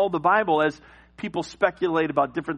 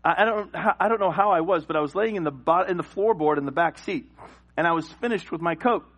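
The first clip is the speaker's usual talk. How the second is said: ordinary talk, nothing marked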